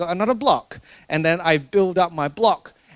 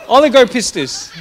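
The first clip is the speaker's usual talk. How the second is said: loud, higher, hammed-up Italian accent; terrible Italian accent; 4 semitones higher, 10 dB louder than usual. Preacher joking that it sounds like Italian food, hammed-up Italian accent. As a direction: ham it up in a bad Italian accent. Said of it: "That was a terrible accent".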